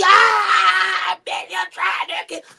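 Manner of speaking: hammed-up outraged cry; a mock yell fading fast; Modesty yelling.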